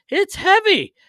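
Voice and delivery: high, loud mock complaint; imitated complaint. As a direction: squeal it out as an imitation of him. Imitating goalie in tight suit.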